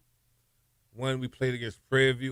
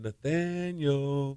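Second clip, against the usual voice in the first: drawn-out, soft mock holler; imitated holler; 3 semitones higher, 7 dB quieter, much slower than usual. Opponents taunting him across the field, mock hollering. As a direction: imitate a taunting call, stretching out the name. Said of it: "they kept hollering".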